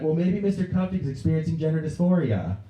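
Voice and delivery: put-on high-pitched girl chiming in; schoolgirl. Girl in class speculating about teacher, high girlish voice. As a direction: offer it up in a high girl's voice. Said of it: "then this girl was like".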